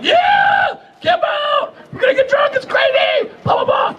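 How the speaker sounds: squealing with retold excitement; excited shouting. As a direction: hype it up like a rookie at camp. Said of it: "I'm all excited".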